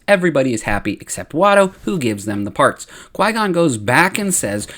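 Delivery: normal talking voice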